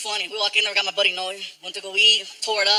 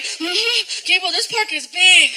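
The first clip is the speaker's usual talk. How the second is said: winded Frankie; 7 semitones higher, much slower than usual. Retold or imitated. Winded kid voicing Frankie.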